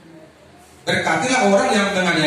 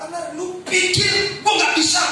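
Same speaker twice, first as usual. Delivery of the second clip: angry disciple; 9 semitones higher than usual. Retold or imitated.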